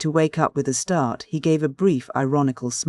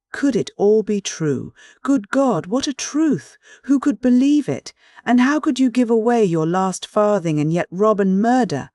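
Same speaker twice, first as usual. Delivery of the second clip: high, loud, anguished incredulity; appalled disbelief; 8 semitones higher, 5 dB louder than usual. A narrator's reading. Sonia reacting to his confession of murder, appalled. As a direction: cry out in horrified amazement.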